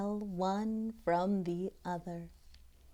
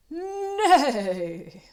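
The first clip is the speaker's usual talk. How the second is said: louder, higher horse neigh on the word; mighty neigh; 7 semitones higher, 9 dB louder than usual. A narrator's reading. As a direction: unicorn neighing proudly, lightly voiced neigh. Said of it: "He neighs a mighty".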